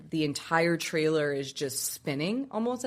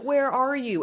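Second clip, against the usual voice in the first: high, raised frantic question; retold freak-out; 7 semitones higher, 4 dB louder, slower than usual. Mom to the child she can't get answers from, freaking out. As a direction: imitate a mother demanding answers in alarm. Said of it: "So she's freaking out".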